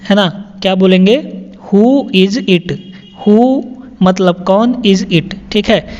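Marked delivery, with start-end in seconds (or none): 1.7-3.6 "Who is it?" said very slowly, each word apart; slow clear repetition. Shown as a demonstration. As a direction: model it slowly for the class. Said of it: "teacher repeats sentence slowly". (धीरे-धीरे साफ़ बोलना)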